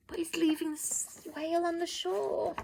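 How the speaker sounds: soft worried whisper; hushed whisper. A narrator's reading. Reader whispering the whale is stranded. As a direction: breathe it out, worried.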